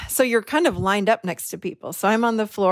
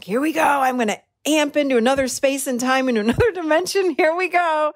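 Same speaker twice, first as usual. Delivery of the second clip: higher-pitched, keyed-up self-talk; eager anticipation; 5 semitones higher than usual. Retold or imitated. Psyching herself up for the meditation, eager. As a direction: act out being all in and raring to go.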